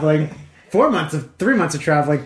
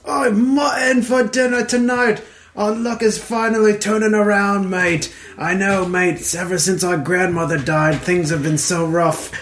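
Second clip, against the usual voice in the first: higher, hammed-up dim ogre; 4 semitones higher than usual. Second ogre cheers about dinner, hammed-up and high. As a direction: shout it like a thick-headed brute.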